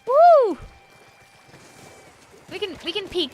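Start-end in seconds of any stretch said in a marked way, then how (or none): 0.1-0.6 loud, high "woo"; excited whoop. Real, not acted. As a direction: let out a high cheering whoop. Cheering at patch news.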